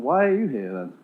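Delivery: ordinary talk, nothing marked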